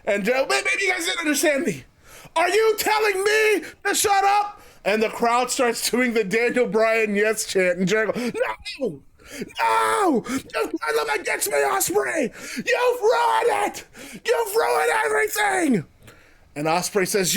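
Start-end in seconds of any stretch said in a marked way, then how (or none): 0.6-4.6 high-pitched affronted wrestler; Jericho, disbelieving. Acted. Wrestler re-asks a crowd that turned on him, high and affronted. 8.8-15.9 high, drawn-out wail of outrage; Jericho, tantrum. Acted. Wrestler blames rival for hostile crowd, high petulant tantrum.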